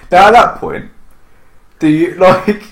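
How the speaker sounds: usual speaking voice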